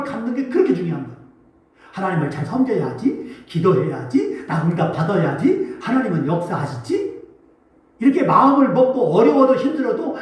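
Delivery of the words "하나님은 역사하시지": ordinary talk, nothing marked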